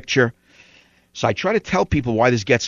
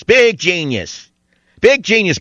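slow sneering higher mock praise; sarcastic; 6 semitones higher, slower than usual. Mocking Spielberg as genius.

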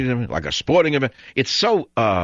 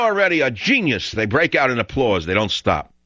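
ordinary talk, nothing marked

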